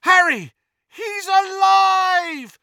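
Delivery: high, drawn-out shout of shock and joy; crowd screams. A narrator's reading. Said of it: "the screams on every side".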